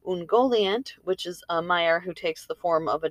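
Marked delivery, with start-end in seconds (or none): none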